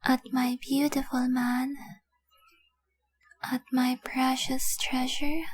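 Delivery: slow, breath-filled reverie, long pauses between words; entranced adoration. Acted. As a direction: drift off softly while gazing at your treasure. Entranced staring at her treasure.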